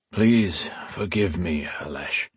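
slow, weary plea, sinking away. A narrator's reading. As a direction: let it trail off, worn out. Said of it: "he said in a weary voice".

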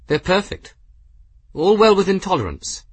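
high voice, falling firmly at the end. A narrator's reading. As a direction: insist on it in a high, lifted voice. Figure defends the engine readings.

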